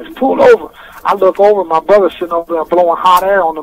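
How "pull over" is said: higher and louder command; a retold cop's shouted order; mock trooper yell. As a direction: shout it through the window. Trooper yelling from beside car.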